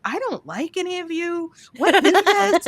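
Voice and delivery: retold dislike in a high, light voice; mock disdain. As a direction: play up the disdain, pitched high. Retells silent defiance in groups.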